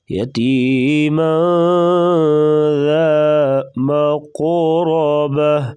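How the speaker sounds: slow, melodic tajweed chant; Quran recitation. Shown as a demonstration. Teacher reciting for students, slow melodic tajweed. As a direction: draw each word out slowly in recitation. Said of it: "یہاں پر اخفا ہوگا".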